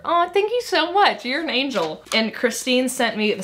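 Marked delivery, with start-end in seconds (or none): 0.1-2.0 a high, melting aw of gratitude; touched thanks. Real, not acted. Reading a friend's birthday note, touched and grateful. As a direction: soften and lift into a touched thank-you.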